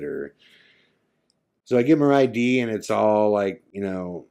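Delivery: ordinary talk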